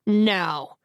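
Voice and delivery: a mild narrated shout, sharp and commanding; understated angry command. A narrator's reading. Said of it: "Jimmy shouts in a surprisingly loud voice".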